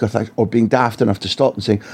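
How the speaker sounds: usual speaking voice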